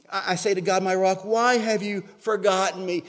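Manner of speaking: loud plea to God; self-pitying complaint. Acted. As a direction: call out a wounded protest to God. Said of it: "David is starting to feel s-, sorry for himself".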